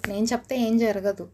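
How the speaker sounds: ordinary talk, nothing marked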